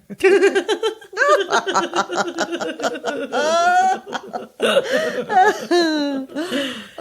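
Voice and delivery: long high-pitched cackling laugh; long high cackle. Acted. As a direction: cackle away at the slip. Old lady laughs at her innuendo.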